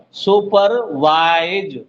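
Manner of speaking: सुपरवाइज slowly, सुपर then a long वाइज; slow sounding-out of सुपरवाइज; syllable breakdown. Shown as a demonstration. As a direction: stretch the parts of सुपरवाइज. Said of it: "तीन syllable के word है ये".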